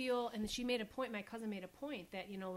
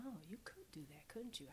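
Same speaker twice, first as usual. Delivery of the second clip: soft teasing whisper; mock impressed; 3 semitones lower, 11 dB quieter than usual. Wife softly humoring her husband's tough talk. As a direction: humor him with a hushed fake awe. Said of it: "and I'm like".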